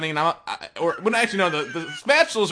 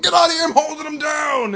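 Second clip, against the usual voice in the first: loud, high-pitched battle scream; retold screaming; 11 semitones higher, 5 dB louder than usual. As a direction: scream orders at your teammates over the chaos. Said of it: "I'm screaming at the pancakes".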